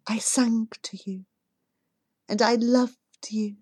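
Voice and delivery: soft and tender, a hint of a mother speaking from memory; gentle remembered voice. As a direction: speak softly, like a loving voice from the past.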